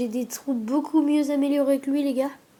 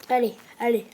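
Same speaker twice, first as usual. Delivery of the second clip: ordinary talk, nothing marked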